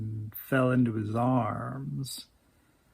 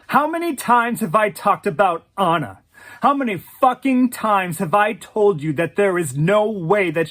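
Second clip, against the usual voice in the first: loud, exasperated tirade, high-pitched; yelling; 4 semitones higher, 7 dB louder than usual. Acted. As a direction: yell your questions at him, furious. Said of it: "She yelled".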